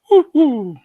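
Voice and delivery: high, loud hoots; excited owl hoot. Acted. Otto the Owl greets the visitors, excited high hooting. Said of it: "You sound a little too excited".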